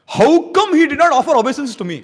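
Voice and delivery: high, loud offended demand; indignant question. Acted. Sage Vishwamitra snubbed by Mahendra, loud and indignant. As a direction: bristle at the insult.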